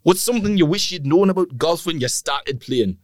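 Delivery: high, wobbly mock Geordie accent; botched Geordie accent. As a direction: try the Geordie voice again and lose it. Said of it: "your Geordie becomes Punjabi".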